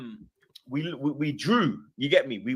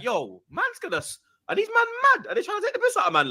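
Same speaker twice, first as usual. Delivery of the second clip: much higher, half-laughing disbelief; incredulous. Retold or imitated. Fans calling out to him on the walk, high incredulous disbelief. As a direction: voice your rattled inner thoughts.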